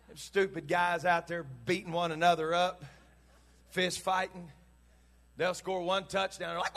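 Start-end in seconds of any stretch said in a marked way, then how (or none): none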